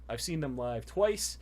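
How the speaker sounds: ordinary talk, nothing marked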